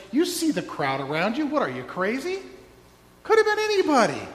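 mock them for asking, loud and high; disbelieving disciples, pitched high and fast; scoffing disciples.

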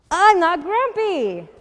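loud, high, drawn-out protest; indignant denial. A narrator's reading. Jim insists to Norman and Snake, loud and indignant. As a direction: insist crossly, stretching it out.

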